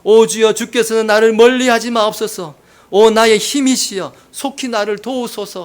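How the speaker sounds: acted fervent supplication; earnest prayer. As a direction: plead with God, easing off at the end. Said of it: "간절하게 또 이렇게 기도하는".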